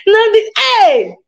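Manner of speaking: high playful squeal; giggly squeal. Pretend. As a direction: squeal like a giddy lover. Lovers' call, squeal.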